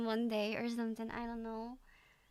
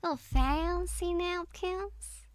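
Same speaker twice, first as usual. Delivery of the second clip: slow, higher, drawled Southern try at "Little fancy napkins"; attempted Southern; 6 semitones higher, slower than usual. Shown as a demonstration. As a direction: learner tries the Southern accent after the lesson, slowly. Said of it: "if I do the Southern accent".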